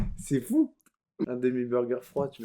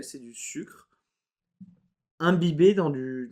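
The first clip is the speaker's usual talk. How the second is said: usual speaking voice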